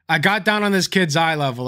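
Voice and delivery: ordinary talk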